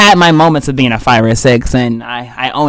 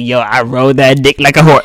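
crude talk with friends; 3 semitones lower than usual. Retold or imitated. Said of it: "But I'm talking to my friends".

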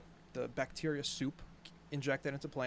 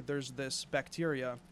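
normal talking voice

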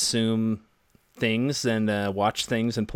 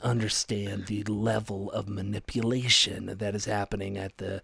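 AM radio voice. Acted. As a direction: lean in like a late-night AM host. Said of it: "You can't do that voice anymore".

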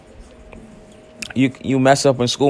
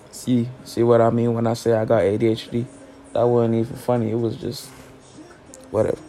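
ordinary talk

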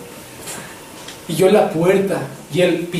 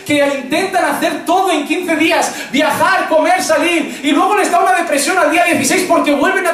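high, driving sermon delivery, building to a shout; fervent preaching; 6 semitones higher than usual. Acted. Pastor urging the brothers, in a fervent high preaching voice. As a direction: preach it hard, climbing in pitch.

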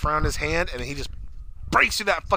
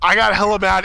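ordinary talk

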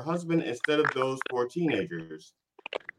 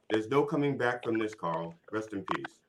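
normal talking voice

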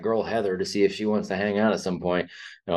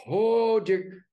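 mock alarm; 14 semitones higher than usual. Watching a drunk shove a fighter's face, mock alarm. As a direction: squeak it out in pretend alarm. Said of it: "And I'm going".

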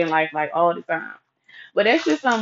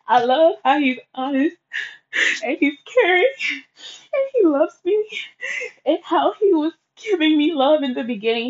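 crying; 8 semitones higher than usual. Retold or imitated. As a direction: voice climbing higher through tears. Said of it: "So I'm crying to Mister Bruce".

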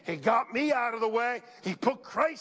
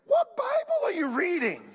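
incredulous retort; 8 semitones higher, 3 dB louder than usual. Real, not acted. Indignant rhetorical retort.

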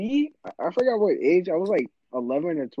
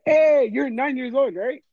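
high, slow mock question; mimicking his friend; 10 semitones higher, slower than usual. Kid imitating the friend who always thinks he's nine, mock question. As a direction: imitate him with a drawn-out, high greeting.

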